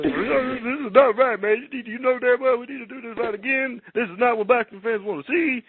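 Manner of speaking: high-pitched, throaty promoter impression; hammed-up boxing promoter rant; Don King. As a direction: talk like a blustering lawyer, voice pushed up high. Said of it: "he talks real fast like a lawyer".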